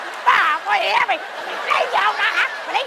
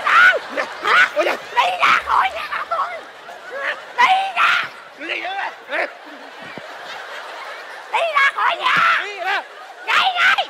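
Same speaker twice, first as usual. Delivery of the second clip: laughing fake fury, much higher; mock angry shout; 7 semitones higher, much slower than usual. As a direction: chase them out of the house, half laughing. Wife chasing intruder out.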